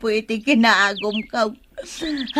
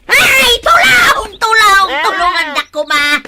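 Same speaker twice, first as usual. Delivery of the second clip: shouting for help; 9 semitones higher, 6 dB louder than usual. Acted. Dragged by the goat, screaming for someone to help. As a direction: yell for rescue at the top of your voice.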